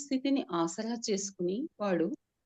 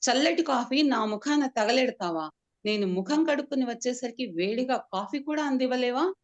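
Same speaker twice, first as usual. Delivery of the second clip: higher, louder furious scolding; roaring at wife; 4 semitones higher, 4 dB louder than usual. Acted. Husband berates his wife over coffee, angry roar. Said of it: "అని హుంకరిస్తూ".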